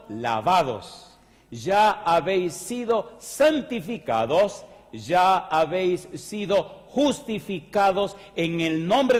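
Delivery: slow, loud, high scripture proclamation; full-force pulpit delivery; fiery preaching. Acted. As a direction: proclaim each phrase like a trumpet call.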